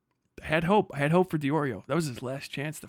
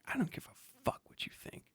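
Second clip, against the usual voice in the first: defiant whisper; 8 semitones higher, 10 dB quieter than usual. Acted. Defiant whispered retort to critic.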